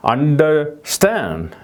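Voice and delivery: very slow understand, the weight on STAND; slow stress on stand. Shown as a demonstration. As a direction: draw out understand, rising to STAND. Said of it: "이렇게 발음이 되는 거죠".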